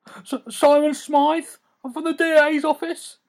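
a terrified lawyer squeaking out his name; whimpered. Acted. Lawyer dangling in Batman's grip whimpers his name, high and stammering. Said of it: "the man whimpered".

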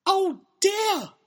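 very high, loud squeal; hammed-up high-pitched alarm; panicked girl's cry. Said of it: "cried Emily".

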